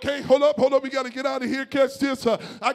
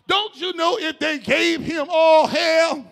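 stretched, building preacher calls; preaching; 4 semitones higher, slower than usual. Acted. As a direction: preach it to the room. Preaching to the congregation.

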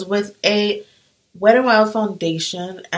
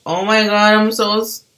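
usual speaking voice

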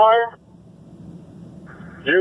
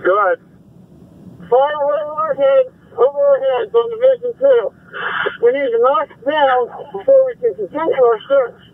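urgent firefighter radio; 7 semitones higher than usual. Real, not acted. Emergency traffic.

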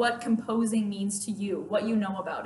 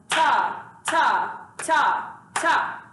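TA as one long, even beat each time; rhythm syllable demo; much slower than usual. Shown as a demonstration. Teacher shows students how ta sounds on the beat, steady rhythmic chant. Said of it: "Ta is gonna sound like this".

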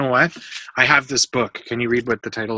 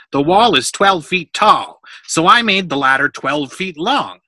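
higher, louder, emphatic insistence; argumentative; 6 semitones higher, 10 dB louder than usual. A narrator's reading. Workman defending the ladder he made, argumentative. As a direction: defend the work point by point.

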